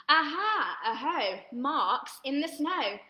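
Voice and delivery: slow, loud, drawn-out chant; dramatic refrain. A narrator's reading. Reading to children at home, a big suspenseful chant. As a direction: make it a big suspenseful story moment.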